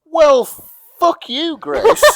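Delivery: high, loud laughing protest; laughing mock outrage. Real, not acted. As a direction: fake offense through laughter.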